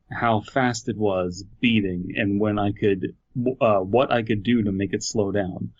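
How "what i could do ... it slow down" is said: normal talking voice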